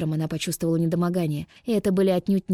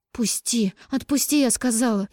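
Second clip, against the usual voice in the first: struggling against restraints; 5 semitones higher than usual. A narrator's reading. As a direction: yell while twisting free. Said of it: "Выкрикивая, Даша извивалась, пыталась вырваться".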